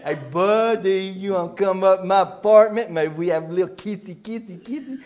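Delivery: hammed-up come-on in a low croon; Buddy's mock seductive woman, sing-song; flirting woman. Woman invites Buddy up to her apartment, mock seductive. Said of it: "She said".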